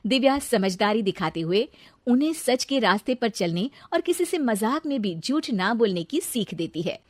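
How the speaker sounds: plain reading voice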